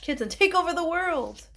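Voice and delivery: high, loud spooky climax; dramatic build. Acted. Kid hyping up the robot zombie plot, high loud. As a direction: make it sound like a horror trailer.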